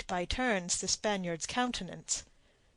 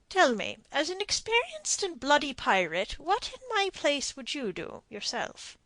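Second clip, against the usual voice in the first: higher, pointed, challenging captain; Captain Blood; 6 semitones higher than usual. A narrator's reading. Blood quizzes the pirate, pointed. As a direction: make him answer himself.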